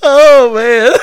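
loud laughing exclamation; gasping through laughter; laughing hard. Real, not acted. Laughing hard after joke.

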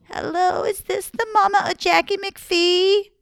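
put-on high sweet old lady. Old woman calls Jackie's mama, high sweet old lady. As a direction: sweeten it like a grandma. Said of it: "she heard".

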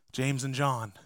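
ordinary talk, nothing marked

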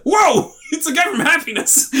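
high, excited exclamation; hammed-up astonishment. Viewer recognising the guy from Happiness, high mock astonishment. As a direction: burst out in retold amazement, pitch way up.

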